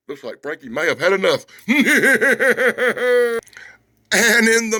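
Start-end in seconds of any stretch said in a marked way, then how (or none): 1.7-3.4 a burst of real laughter. Real, not acted. Reviewer laughing at the comic fight, real laugh.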